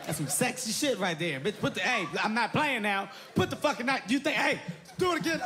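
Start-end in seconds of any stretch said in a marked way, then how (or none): 1.4-5.4 rising panicked pleading, higher and higher; panicked plea. Retold or imitated. A man begging his knife-wielding woman, voice rising in panic. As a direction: beg her to drop the knife, voice climbing.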